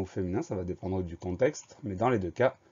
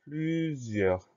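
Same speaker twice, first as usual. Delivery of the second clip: higher, drawn-out dictated word; slow dictation; 5 semitones higher than usual. Shown as a demonstration. Teacher announces the next spelling word, slowly and higher.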